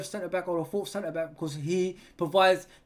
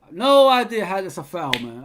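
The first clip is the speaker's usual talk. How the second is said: loud, exasperated protest at the ref; frustrated outburst; 8 dB louder than usual. Real, not acted. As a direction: blurt it out in disbelief. Fan outraged at referee's call.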